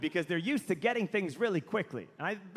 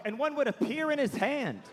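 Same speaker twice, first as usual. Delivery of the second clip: ordinary talk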